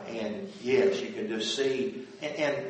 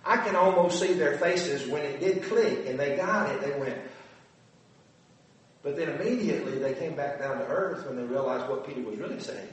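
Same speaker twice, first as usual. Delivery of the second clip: ordinary talk, nothing marked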